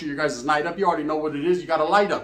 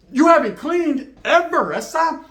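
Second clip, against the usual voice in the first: high, loud, slow sarcastic jab; mocking retort; 10 semitones higher, 5 dB louder, slower than usual. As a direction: drag it out to mock her. Mocks girl's excuse.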